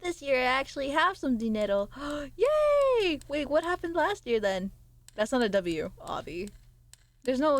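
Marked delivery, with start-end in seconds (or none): 2.0-3.2 a bright gasping yay; gasp and cheer. Real, not acted. Streamer finds she has money, gasping cheer. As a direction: gasp then cheer with delight.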